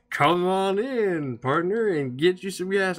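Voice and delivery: high, slow drawling gas pump voice; creepy pump voice. Retold or imitated. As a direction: stretch it out, high and eerie, like a talking pump. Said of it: "I hear this voice from the outside say".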